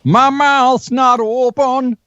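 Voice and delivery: high, slow, mumbled through a barely open mouth; marbles in mouth. Shown as a demonstration. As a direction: sing like your mouth is full of marbles. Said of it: "singing with marbles in your mouth".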